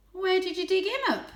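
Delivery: high, loud teasing cat; playful teasing. A narrator's reading. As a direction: tease playfully. Tam teasing, higher.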